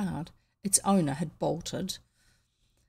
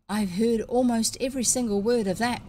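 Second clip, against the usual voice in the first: ordinary delivery, nothing marked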